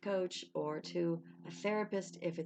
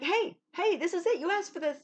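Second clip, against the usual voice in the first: calling out; 11 semitones higher, 7 dB louder than usual. Retold or imitated. The universe calling for attention.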